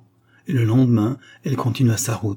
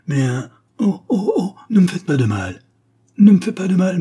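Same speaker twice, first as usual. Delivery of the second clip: high, loud, pleading oh oh; frightened cry; 8 semitones higher, 6 dB louder than usual. A narrator's reading. Little girl begging the devil in the forest, high frightened cry. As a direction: beg the devil not to hurt her, voice rising. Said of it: "cria la petite fille".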